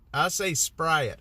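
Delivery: "Sprite" stretched to two syllables, SPRY-it; slow, with an extra vowel in "Sprite"; odd Sprite pronunciation. Real, not acted. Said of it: "I say Sprite".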